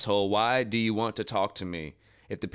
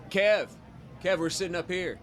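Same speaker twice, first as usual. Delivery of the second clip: calling out; 9 semitones higher than usual. Real, not acted. Calling to brother in stands.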